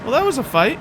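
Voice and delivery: high, loud exclamation; shaken surprise. Real, not acted. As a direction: still rattled from the scare. Right after startled scream.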